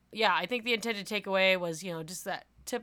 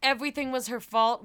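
ordinary delivery

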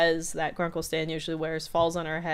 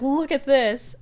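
emphatic; 8 semitones higher, 6 dB louder, slower than usual. Retold or imitated. Dipper shows mirror.

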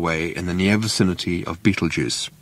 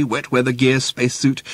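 plain reading voice

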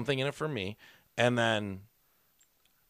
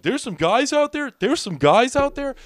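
high, loud complaint; whiny tattletale; 10 dB louder than usual. Retold or imitated. Biker complaining to the bartender, high and loud. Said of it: "he probably told him".

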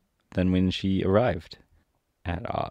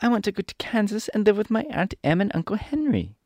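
put-on high little-girl voice, protesting; protesting Dorothy; 13 semitones higher than usual. Dorothy refuses to stay in Emerald City, high protest. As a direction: cry out in a high girlish voice. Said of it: "cried Dorothy".

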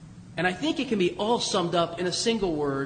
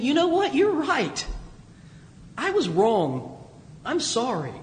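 raised, halting apology, slowing on I was wrong; humble admission; 5 semitones higher, slower than usual. Acted. Confessing to a neighbor, a lightly acted humble apology. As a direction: swallow your pride and admit it haltingly. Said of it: "Those are hard, hard words to say".

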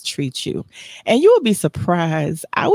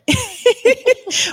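high laugh. Real, not acted. Host laughs.

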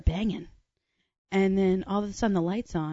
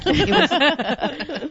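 higher-pitched, through laughter; laughing; 7 semitones higher, 3 dB louder than usual. Real, not acted. Guest describing a giant wine glass to friends, laughing. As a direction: break into a laugh mid-phrase.

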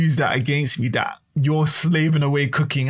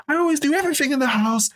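much higher, fast mock mum whine; mimicking mum's complaint; 11 semitones higher, much faster than usual. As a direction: rattle it off like the martyred mum. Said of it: "I was like".

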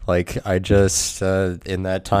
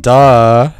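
loud, higher-pitched mocking duh; exasperated shout; 4 semitones higher, 10 dB louder than usual. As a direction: shout it like it's painfully obvious. Exasperated outburst at imagined listeners.

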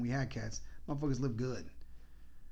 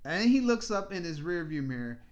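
ordinary delivery

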